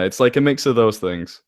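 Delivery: ordinary talk, nothing marked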